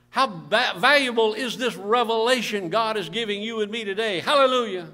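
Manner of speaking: preacher building on repeated phrases; forceful preaching. Real, not acted. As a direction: press each point home to the congregation.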